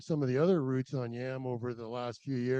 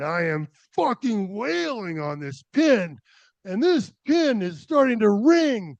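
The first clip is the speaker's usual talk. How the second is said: louder, higher, surging with triumph; excited; 7 semitones higher, 10 dB louder than usual. Retold or imitated. Climber reliving hammering the pin, rising excitement. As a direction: ramp up as the pin bites.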